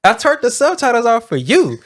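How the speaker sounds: high, baffled self-defence; incredulous, mock-wounded comeback; retold disbelief. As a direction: protest as if wrongly accused, pitch jumping. Acted baffled defence in quarrel.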